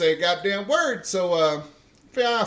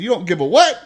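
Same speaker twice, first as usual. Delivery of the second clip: louder, indignant challenge; incredulous challenge; 4 semitones higher, 8 dB louder than usual. Retold or imitated. Sergeant challenging the recruit's cussing, loud and indignant. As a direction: dare him to repeat it. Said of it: "He said".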